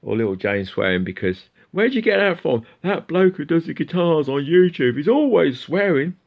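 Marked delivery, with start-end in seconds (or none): 1.7-6.2 high, loud put-on voices; hammed-up high skit voice; mock parent and child. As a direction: act out a telling-off in a raised pitch. Voices parent and child about swearing.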